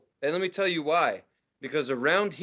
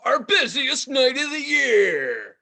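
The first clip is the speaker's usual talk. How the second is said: monster truck ad; 8 semitones higher, 5 dB louder, much slower than usual. Pretend. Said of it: "a monster truck announcer to do a commercial".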